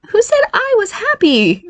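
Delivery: put-on sharp comeback from the transformed boy; retort. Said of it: "Barry retorted".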